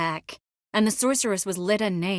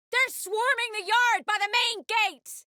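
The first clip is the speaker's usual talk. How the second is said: high, urgent warning cry; shouted alarm; 13 semitones higher than usual. Acted. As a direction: call out the attack at the top of your range. Alarm call during an attack.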